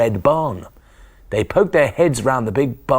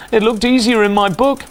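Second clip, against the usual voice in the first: high, loud yell over the engine; 10 semitones higher, 6 dB louder than usual. Acted. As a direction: shout it over a roaring motor. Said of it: "he yelled".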